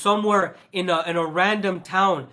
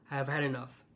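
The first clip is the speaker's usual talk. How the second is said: drops much lower and softer, worn out; quiet, fed up; 6 semitones lower, 11 dB quieter, faster than usual. Real, not acted. Vlogger repeating to viewers, quiet and exhausted. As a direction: let it sink into a tired, quiet sigh of a line.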